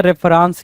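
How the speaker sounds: रेफरेंस with long "raans" ending; misspelling read out. Shown as a demonstration. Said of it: "रेफरेंस तो कुछ नहीं होना चाहिए".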